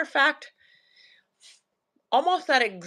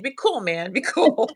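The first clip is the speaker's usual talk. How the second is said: laughing; 4 semitones higher, 6 dB louder than usual. Retold or imitated. Retelling calming her son, laughing.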